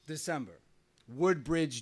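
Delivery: ordinary delivery, nothing marked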